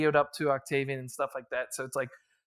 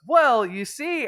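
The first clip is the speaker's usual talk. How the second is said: mock explainer; 8 semitones higher, 6 dB louder, slower than usual. Cathor starts explaining.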